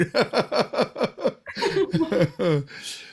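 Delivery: long high burst of real laughter. Real, not acted. Host keeps laughing at his own admission.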